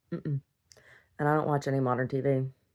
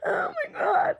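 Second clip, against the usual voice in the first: squealing laugh; slower than usual. Real, not acted. Cry-laugh exclamation.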